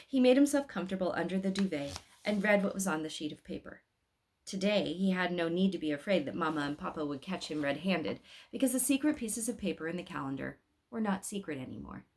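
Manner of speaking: ordinary delivery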